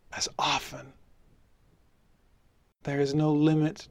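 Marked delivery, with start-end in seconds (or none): 0.1-0.9 breathy whisper of the key phrase. Shown as a demonstration. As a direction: hush it, savour the words. Repeating the key phrase for emphasis.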